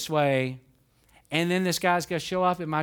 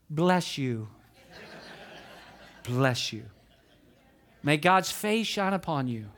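mock saintly blessing; 5 semitones lower than usual. Ironic saintly blessing he didn't give.

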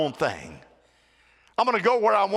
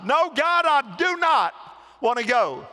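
whiny refusal; 6 semitones higher than usual. Retold or imitated. Jonah voiced refusing God.

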